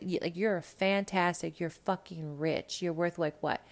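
ordinary talk

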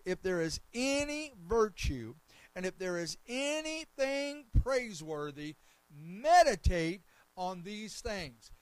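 loud, slow, emphatic scripture proclamation; ringing sermon delivery, phrase by phrase; preach. Real, not acted. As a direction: proclaim each phrase at full force.

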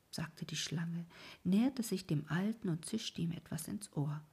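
plain reading voice

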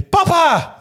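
put-on high, loud childlike cry of "Papa!"; mock call for daddy. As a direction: shout "Papa!" like an excited child. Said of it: "roep je". (roep hoog en luid "Papa!" als een blij kind)

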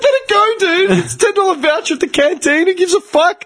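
high-pitched laughing quote; laughing retort. Retold or imitated. Retelling telling the coworker to forget the voucher, laughing high.

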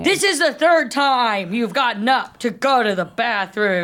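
slow, emphatic imitated telling-off; elevated mock scolding. Woman re-enacting her date's bathroom complaint, escalated. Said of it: "elevated his voice, escalated his voice".

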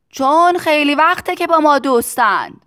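shouted answer. A narrator's reading. Factory owner explaining to Tistu over noise, shouting. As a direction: explain at the top of the voice.

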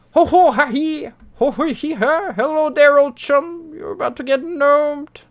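very high, chortling gnome voice; high-pitched cartoon gnome; gnome meme impression. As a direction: squeal it like a mischievous little gnome. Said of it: "That's pretty much what he's going for here".

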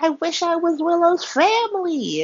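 high mock whine; whiny Harmony. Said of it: "Harmony's like".

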